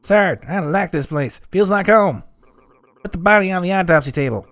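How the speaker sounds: high, fast cartoon voice; character voice. Voicing game character.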